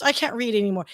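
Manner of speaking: ordinary delivery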